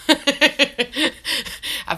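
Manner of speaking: slow, loud, laughing through it. Real, not acted. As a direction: laugh the line out slowly. Laughing at own joke.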